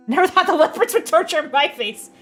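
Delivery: laughing. Real, not acted. Laughing at the game's line.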